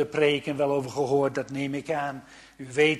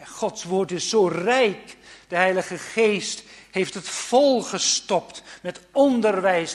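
louder, passionate preaching; fervent preaching; 6 semitones higher, 10 dB louder than usual. Acted. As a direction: build with conviction. Preaching rising again. (vurig en luider preken)

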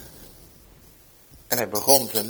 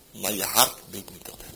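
whisper; 7 semitones lower, 10 dB quieter than usual. Real, not acted. Hushed warning to the man.

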